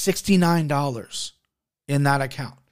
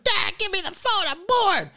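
put-on much higher whiny little kid voice; four-year-old. Dad imitating his bored four-year-old asking for the phone. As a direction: squeak it out like a bored small child. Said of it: "Four-year-old's like".

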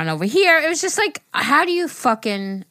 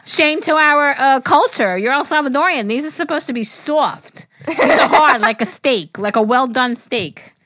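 mock scolding; 5 semitones higher, 4 dB louder than usual. Scolding husband, retold.

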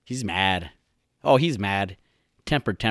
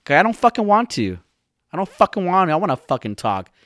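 high, louder mock defiance; defiant; 8 semitones higher, 4 dB louder than usual. Podcaster answering YouTube commenters who want others talking, defiant. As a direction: answer back stubbornly, pitched way up. Snapping back at imagined commenters.

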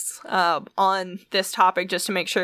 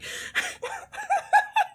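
squealing far-off laugh; high laugh; 5 dB quieter than usual. Real, not acted. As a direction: shriek with laughter away from mic. Laughing off mic.

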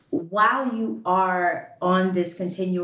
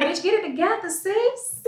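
cussing herself out, much higher and sassy; self-scolding rant, much higher; 12 semitones higher than usual. Retold or imitated. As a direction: snap at yourself like a fed-up sister. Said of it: "I be like".

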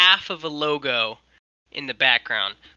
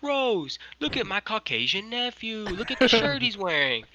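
proud showing off; 8 semitones higher than usual. Retold or imitated. Chester shows off to Rose.

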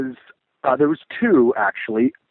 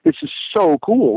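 thrilled inner thought; 10 semitones higher than usual. Retold or imitated. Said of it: "And I thought".

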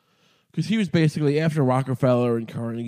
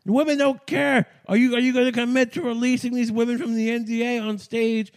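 mock debate heckler; 8 semitones higher than usual. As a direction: ham up the outraged debater demanding an answer. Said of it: "they're saying that, like".

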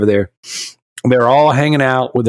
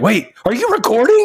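put-on high, alarmed little kid; mock kid panic; startled kid voice; 11 semitones higher than usual. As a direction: squeak it like a caught seven-year-old. Son noticing the camera.

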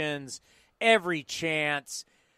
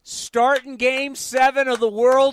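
excited; 6 semitones higher, 7 dB louder, slower than usual. Acted. Hyping the movie pitch.

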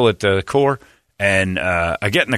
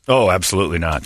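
usual speaking voice

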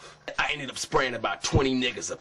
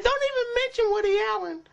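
very high put-on female voice; mock woman's voice; 3 dB louder, faster than usual. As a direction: squeal it as a caricatured woman. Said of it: "She said".

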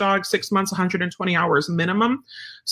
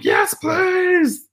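eager dog voice; 12 semitones higher than usual. Retold or imitated. Trainer voicing a dog that runs to the clicker, eager high shout. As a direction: shout it like a dog racing over for a treat. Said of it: "that dog runs to you and is like".

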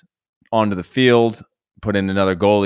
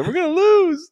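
echoing panicking mom. Retold or imitated.

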